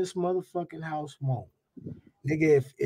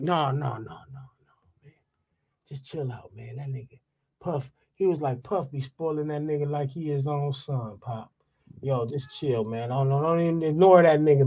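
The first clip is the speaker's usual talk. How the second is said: calming someone down; 7 semitones lower than usual. Retold or imitated. [name] calming the speaker down.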